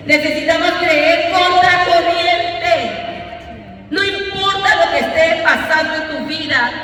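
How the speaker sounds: impassioned preacher declaring faith; preaching at full force. Acted. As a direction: drive each phrase home from the pulpit. Preaches faith against the current.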